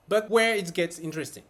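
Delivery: ordinary talk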